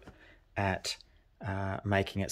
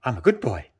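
higher and louder, a playful mock dog bark; shark barking like a dog; 4 semitones higher, 7 dB louder than usual. Card shark pretending to be a good dog, playful high bark. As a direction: bark it out like a proud puppy. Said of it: "the shark there going".

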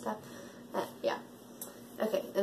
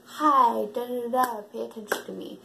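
mock attention-seeking voice; 5 dB louder than usual. Mocking attention-seeking YouTubers.

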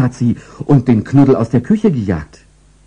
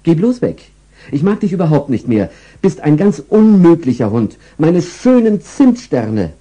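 higher, scolding, lamenting at the end; angry owner; 8 semitones higher, 3 dB louder than usual. Acted. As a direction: drive the dog off in a huff. Frauchen angry at dog.

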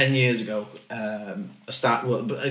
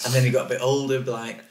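usual speaking voice

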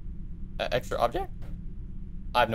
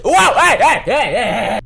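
high, loud repeated hey; alarmed shouting; 6 semitones higher, 6 dB louder, slower than usual. Real, not acted. Shouting at anomaly.

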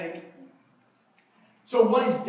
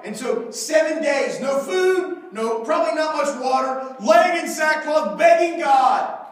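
normal talking voice

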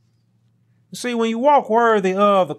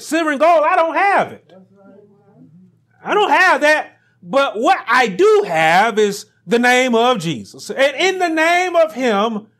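raised commanding apostle voice, higher pitch; Peter's command; 4 semitones higher, 3 dB louder than usual. Acted. Preacher voicing Peter to the beggar, higher and commanding. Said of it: "he said".

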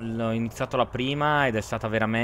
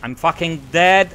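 frustrated yell; 5 semitones higher, 6 dB louder, slower than usual. Real, not acted. Plane shot down mid-battle.

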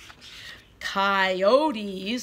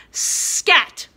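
loud storybook shout; 7 semitones higher, 7 dB louder than usual. Shown as a demonstration. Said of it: "We say it loud".

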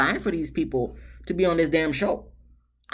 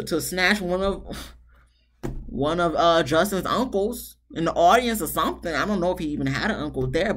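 usual speaking voice

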